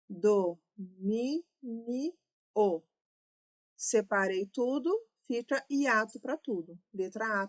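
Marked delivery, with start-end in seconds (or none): 0.1-1.4 Domínio spoken slowly, syllables held apart; drawn out slowly. Shown as a demonstration. Teacher to class, stretching Domínio slowly to split syllables. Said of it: "teacher stretches word to split it".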